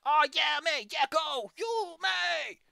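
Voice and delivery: high excited shout; excited cheering. Retold or imitated. Imagining playing Night in the Woods, cheering excitedly. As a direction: cheer like a thrilled player. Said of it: "I'm like".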